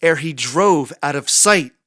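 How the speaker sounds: plain reading voice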